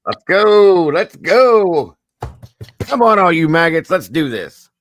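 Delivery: barked orders, loud and high; drill sergeant. Pretend. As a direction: yell like a drill sergeant at recruits. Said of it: "Diego's like a drill sergeant".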